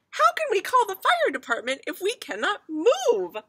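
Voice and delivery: high, loud protest; distressed mother. A narrator's reading. Mother argues with father about moving, high distressed voice. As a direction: argue back in a high, upset voice. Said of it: "were arguing".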